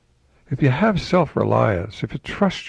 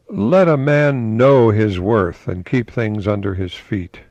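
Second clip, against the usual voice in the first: slow, louder recitation; quote reading; 5 dB louder, slower than usual. Acted. Host quoting Emerson to viewers, slow declamation. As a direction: lay out each word as a maxim.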